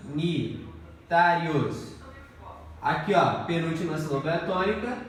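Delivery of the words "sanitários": "Sanitários" very slow, split into syllables with pauses. Shown as a demonstration. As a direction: break "Sanitários" into separate syllables. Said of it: "Faça a separação silábica". ("Sanitários" pausado, sílaba por sílaba)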